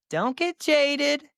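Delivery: high, slow, drawn-out warning; slow, high-pitched plea. Real, not acted. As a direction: stretch each word up high. Emphatic warning to listeners, repeated.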